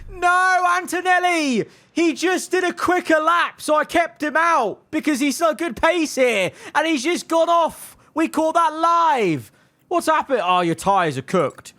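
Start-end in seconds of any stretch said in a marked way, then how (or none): 0.1-10.4 high, anguished cry of dismay; high, stunned dismay at the crash; dismayed outcry. Real, not acted. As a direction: react in shock as your driver crashes. Driver crashes out, streamer dismayed.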